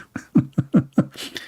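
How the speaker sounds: a soft breathy laugh; a breathy laugh at the comment. Real, not acted. Laughing at married wife.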